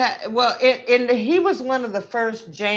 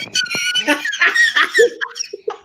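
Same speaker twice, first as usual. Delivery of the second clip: shrieking high laugh; real uncontrolled laughing; high laughter. Real, not acted. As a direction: burst into helpless high laughter. Hysterical over the story.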